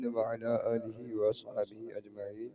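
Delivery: Quranic recitation. Acted. Arabic khutba recitation.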